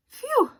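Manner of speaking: high, loud sigh of relief; relieved phew. Acted. Jasper relieved to find no underwear, a high loud phew. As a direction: blow out the worry.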